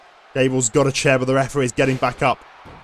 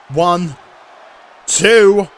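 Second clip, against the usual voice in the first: rising count, second number yelled much higher and louder; excited count; 4 semitones higher, 7 dB louder than usual. Real, not acted. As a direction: call the count as the pin almost lands. Commentator calling near-fall count.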